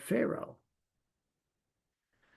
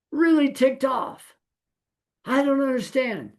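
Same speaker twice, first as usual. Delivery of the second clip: mock angry complaint; 9 semitones higher than usual. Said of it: "shake our fist at God and go".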